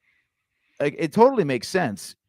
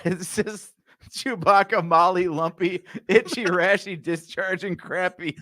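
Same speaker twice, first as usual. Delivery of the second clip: giggling through the list; laughing; 5 semitones higher than usual. Real, not acted. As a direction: laugh between each name. Listing names while laughing.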